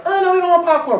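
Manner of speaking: high, prim little refusal; lightly voiced girl turning down popcorn; mock girl voice. A preacher mimicking a girl at the movies, high and prim.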